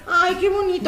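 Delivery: high, nervous mock praise; imitating nervous mom. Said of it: "pero estaba nerviosa".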